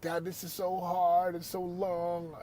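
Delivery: a lighter hint of the whiny son; whiny kid. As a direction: drag the words like a kid who's done walking. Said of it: "He was like".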